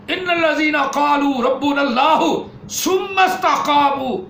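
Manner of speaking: slow, high, loud chanted recitation; Quran recitation. Acted. Preacher reciting a Quran verse, slow chanted recitation. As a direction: recite the verse slowly and fully.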